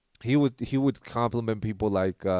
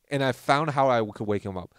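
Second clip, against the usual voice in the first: ordinary delivery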